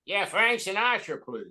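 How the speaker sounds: a lightly voiced Bob Hope asking for [name]; Bob Hope on the phone. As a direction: ask the hospital as Bob Hope. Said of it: "I'm saying".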